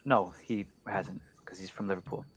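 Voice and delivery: hushed unvoiced aside; whisper. Real, not acted. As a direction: mutter it to yourself under your breath. Answering himself under his breath.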